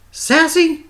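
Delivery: high, loud call of a name. A narrator's reading. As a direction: call her name across the studio. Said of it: "she called out".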